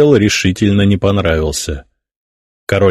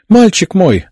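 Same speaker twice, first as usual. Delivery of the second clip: put-on a high, fussing hen-like coo over her boy; clucking; 11 semitones higher, 4 dB louder than usual. A narrator's reading. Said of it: "закудахтала Людмила". (высоко, с квохтаньем, как наседка)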